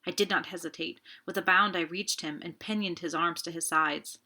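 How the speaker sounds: ordinary delivery, nothing marked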